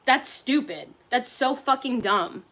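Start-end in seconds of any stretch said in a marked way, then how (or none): none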